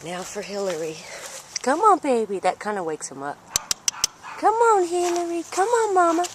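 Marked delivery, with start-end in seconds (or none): 1.6-6.3 much higher, louder sing-song calling; high coaxing call. Real, not acted. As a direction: coax the hen out in a high voice.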